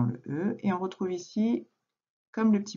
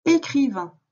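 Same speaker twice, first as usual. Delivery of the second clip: called out high and loud; answer reveal; 7 semitones higher, 6 dB louder than usual. Shown as a demonstration. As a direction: announce the answer with a lift. (réponse annoncée fort)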